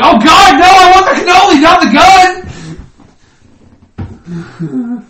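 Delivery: high, loud desperate shout; panicked plea. Pretend. Guest pleading with the Godfather, high and shouting. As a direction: beg for the pastry at full volume. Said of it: "And I'd be like".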